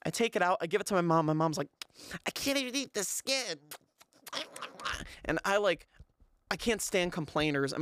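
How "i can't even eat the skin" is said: high-pitched mock complainer voice; put-on higher complaining voice; exaggerated complaining mom. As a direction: caricature mom griping about dinner, pitched high. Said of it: "she doesn't complain like that".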